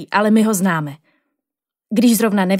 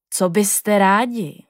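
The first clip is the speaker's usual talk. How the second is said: put-on very slow, calm old teacher's voice; the old teacher surprises the kids from behind, slow and calm; much slower than usual. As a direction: take your time like a kindly old man.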